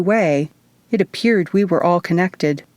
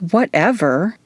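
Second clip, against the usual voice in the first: put-on Valley Girl; 3 semitones higher than usual. A narrator's reading. Izzy brushing off Luke, lightly voiced Valley Girl. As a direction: toss it off like a bored Valley Girl. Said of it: "mimicking a perfectly Valley Girl response".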